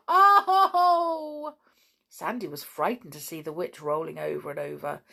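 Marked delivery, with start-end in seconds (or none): 0.1-1.5 long, loud, high wailing "oh"; howl. A narrator's reading. Witch rolling on the grass, howling. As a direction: howl out in despair. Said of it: "she began to howl".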